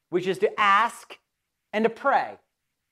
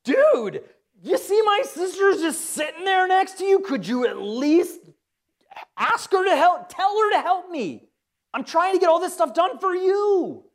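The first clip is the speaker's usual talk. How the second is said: exasperated yelling; 8 semitones higher than usual. Acted. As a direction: demand he make her sister help. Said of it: "She's yelling at Jesus".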